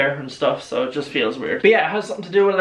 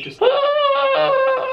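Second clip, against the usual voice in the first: sudden high squeal. Real, not acted.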